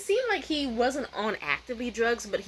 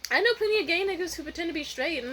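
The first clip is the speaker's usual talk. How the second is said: higher-pitched innocent reply; innocent explanation; 6 semitones higher than usual. Retold or imitated. Audience member answering the comedian, retold innocence. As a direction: explain it as if harmless. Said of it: "innocently, I'm just like".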